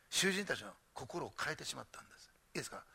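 hushed, breathy whisper; whispered reveal to the congregation. Real, not acted. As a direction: drop to a whisper to draw them in. Hushed emphasis in sermon.

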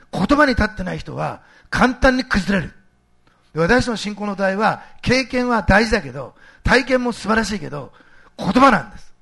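loud, slow, emphatic sermon delivery; forceful preaching. Real, not acted. Preacher tells congregation faith stands on the word, forceful preaching. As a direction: hammer each phrase home to the congregation. Preacher drives home his point.

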